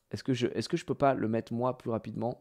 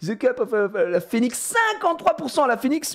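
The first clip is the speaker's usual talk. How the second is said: amazed; 11 semitones higher, 4 dB louder than usual. Real, not acted.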